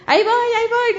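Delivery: high yelled warning while running; retold yelling. Runner yelling to the crowd on the bridge, high shout. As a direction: call out to clear the way. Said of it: "Iba gritando".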